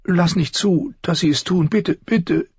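very high, pleading voice climbing towards tears; desperate begging. A narrator's reading. Sansa begging for Lady's life, increasingly tearful. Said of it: "Sie fing an zu weinen".

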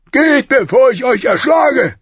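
loud, high-pitched shouted order; furious threat. A narrator's reading. King threatening Sir Barristan, furious shout. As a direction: drive him out with a shouted threat.

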